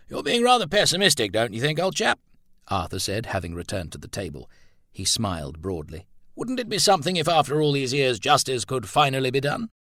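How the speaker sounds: a hearty friend teasing, smiling broadly; jovial old chap. Acted.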